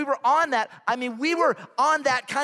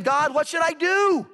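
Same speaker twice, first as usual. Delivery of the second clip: desperate prayer; 6 semitones higher than usual. Acted. Acted desperate prayer from cliff.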